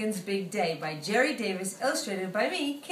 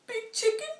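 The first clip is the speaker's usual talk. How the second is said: small chick calling; 12 semitones higher than usual. Acted.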